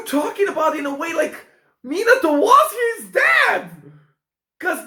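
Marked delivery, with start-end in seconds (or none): 1.8-3.7 high, loud burst of discovery; excited realization. Real, not acted. Viewer working out who the father is, excited. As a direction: blurt the revelation out, pitched way up.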